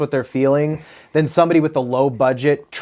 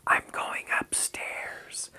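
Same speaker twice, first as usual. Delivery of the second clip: slow, hushed, stilted whisper; imitating a creepy whispering kid; creepy child's whisper; 14 dB quieter, much slower than usual. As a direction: whisper it very slowly, like an eerie movie kid. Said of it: "You're hearing a creepy voice".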